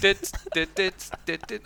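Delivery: mock chirpy "dit dit" melody; voicing the song's intro riff; hummed intro tune. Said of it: "[name]-Intro".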